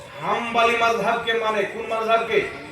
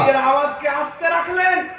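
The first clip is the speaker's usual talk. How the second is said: high, raised preaching cry; emphatic repeat; 5 semitones higher than usual. Real, not acted. Preacher repeating the hadith after the crowd responds, high emphatic cry. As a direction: call it out high to the congregation.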